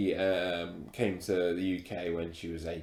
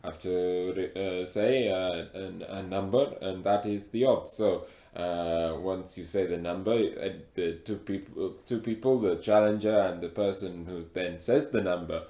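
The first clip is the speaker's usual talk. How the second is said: put-on heavy African accent. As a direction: speak with a thick African accent. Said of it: "It's very hard with the accent".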